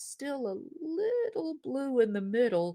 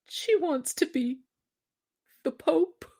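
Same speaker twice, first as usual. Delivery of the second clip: timid husband; 10 semitones higher than usual. Acted.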